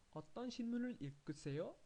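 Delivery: 어떤 신문을 읽으세요 run together quickly, rising; natural fast speed. Shown as a demonstration. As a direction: say it at native speed. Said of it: "And here we have".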